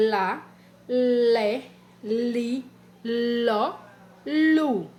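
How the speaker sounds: each syllable said apart: lá, lé, li, ló, lu; syllables spelled out. Shown as a demonstration. Teacher reading cursive syllables to children. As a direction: sound out lá, lé, li, ló, lu for the class. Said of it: "e aqui a cursiva, ó".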